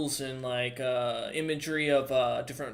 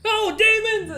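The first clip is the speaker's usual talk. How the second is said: very high, loud terrified cry; panicked shriek; 10 dB louder, slower than usual. Acted. Shopkeeper fleeing the party, high terrified shriek. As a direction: scream and flee. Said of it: "shopkeeper flees screaming".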